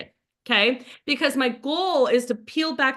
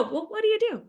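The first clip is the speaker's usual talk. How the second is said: high, friendly, casual question; disarmingly innocent small talk; non-threatening; 10 semitones higher, faster than usual. Shown as a demonstration. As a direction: ask lightly, pitched well up, with easy curiosity. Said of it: "in a way that doesn't feel".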